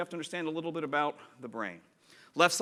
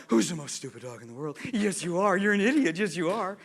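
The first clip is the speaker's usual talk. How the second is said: playful, affectionate pet-owner coo; sing-song sweet talk to a dog; doting dog talk; 3 semitones higher, 5 dB louder than usual. Shown as a demonstration. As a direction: insult the dog as if praising it. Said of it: "He understands the tone".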